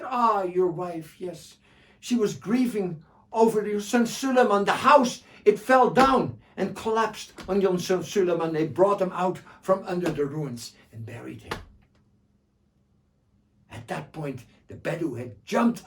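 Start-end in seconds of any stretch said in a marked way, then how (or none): 0.0-11.6 mock sorrow. Joha finishes the tale.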